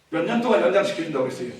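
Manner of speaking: normal talking voice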